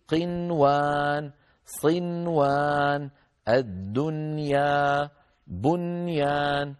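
slow model words, each N kept distinct before W or Y as in صنوان and الدنيا; clear unmerged noon. Shown as a demonstration. Teacher shows Quran students the rule, قنوان said slowly with distinct N. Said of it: "ما نقول قنوان، ما ندغم النون".

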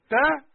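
bright "تا" said with spread lips; smiling ta. Shown as a demonstration. Teacher showing ta with a smile, high and loud. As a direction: smile while saying "تا". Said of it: "إذا ما ابتسمت ما هتطلع التاء".

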